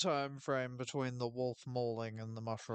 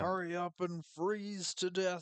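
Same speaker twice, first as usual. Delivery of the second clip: slow, higher mock sneer; playfully imitating a nasty jab; cruel taunt; 5 semitones higher, slower than usual. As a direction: drag the insult out with contempt. Quoting a character's cruel line.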